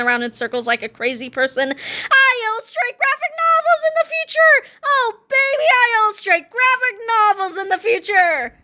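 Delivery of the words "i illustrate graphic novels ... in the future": high-pitched ecstatic screaming; screamed. A narrator's reading. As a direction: keep screaming, crazy with joy. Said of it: "screamed Harold as he jumped up and down".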